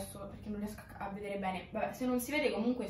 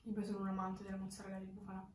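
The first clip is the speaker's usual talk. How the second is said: speaking with food in mouth; mumbled between bites; chewing while talking; 7 dB quieter than usual. Real, not acted. Seen 4: Face clear, holding pizza slice, jaw working between bites throughout.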